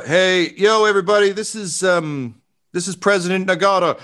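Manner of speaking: high-pitched loudspeaker announcer; President Nagata. Acted. Former president addressing everybody over the loudspeaker, high character voice. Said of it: "Over the loudspeaker, you hear".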